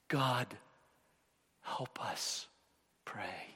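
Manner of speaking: soft, whispered plea, fading to a whisper; hushed prayer. Acted. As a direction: pray it under your breath. Moses' prayer acted softly.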